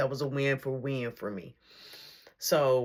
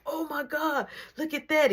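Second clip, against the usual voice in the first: shocked outburst; 12 semitones higher than usual. Retold or imitated. Reacting to the gory scene during the movie, shocked outburst. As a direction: gasp it out at the screen. Scary part of horror movie.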